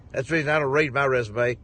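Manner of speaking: normal talking voice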